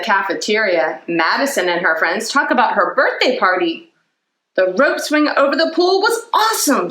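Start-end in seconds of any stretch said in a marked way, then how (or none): 4.5-6.9 a lightly voiced excited kid; higher, eager boy. A narrator's reading. J T bragging about the party, lightly voiced kid. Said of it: "says J T".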